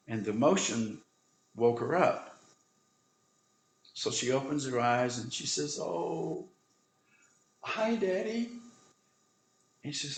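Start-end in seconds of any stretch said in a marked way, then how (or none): 5.8-8.5 put-on high, small child's voice; little girl. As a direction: voice the sick little daughter, weak and sweet. Dying little daughter greets father.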